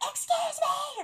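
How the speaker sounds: high, loud calling out; mock yell. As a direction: yell for attention down a hallway. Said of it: "I can't be yelling".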